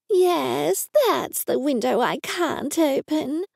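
high, slow whiny complaint; whining. Acted. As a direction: whine it like a fussy mother. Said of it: "Mum said in a whining tone".

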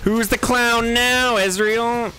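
high, drawn-out gloating taunt. Real, not acted. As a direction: rub it in slowly. Taunting enemy after kill.

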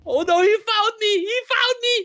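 high-pitched panicked shriek; screaming like a girl. Acted. Player found by the monster, screaming in panic. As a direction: build from loud alarm to a piercing scream. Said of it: "He's screaming like a little girl again".

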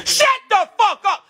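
high, loud outburst; exasperated shout. Real, not acted. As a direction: yell it to cut the assistant off. Cutting off the assistant.

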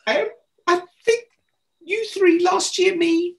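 high squeaky put-upon protest; mock indignation. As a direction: play up the outrage in a falsetto squeak. Indignant thought at the meeting.

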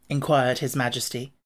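plain reading voice

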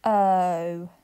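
teacher modelling a phonics sound. Shown as a demonstration. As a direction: clear drawn-out O sound. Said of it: "here it is on our chart".

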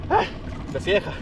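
ordinary talk, nothing marked

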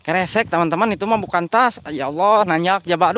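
ordinary talk

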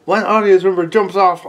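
ordinary delivery

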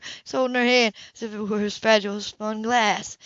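ordinary delivery

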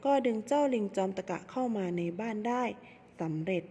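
plain reading voice

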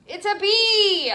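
excited reveal. Real, not acted. Teacher revealing the bee to kids, excited. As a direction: announce it with delight.

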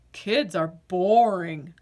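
low, glum potato voice; bored potato. Acted. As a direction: sound like a vegetable with no fun left.

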